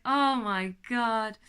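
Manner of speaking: drawn-out overwhelmed oh my God; slow, awestruck; slow awed gasp. Retold or imitated. Overwhelmed by universe thoughts.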